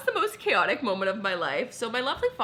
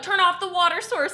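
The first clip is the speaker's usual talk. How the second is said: mock urgent order; 9 semitones higher, 5 dB louder than usual. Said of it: "And he's like".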